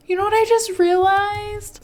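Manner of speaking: high, loud burst of realization; sudden realization. Real, not acted. Gamer recognizing the old house, excited. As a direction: blurt out the discovery.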